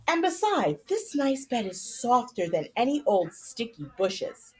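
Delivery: lively storybook narration; reading to children. A narrator's reading. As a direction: read the chapter aloud to the kids with animated character lines. Continues reading story aloud.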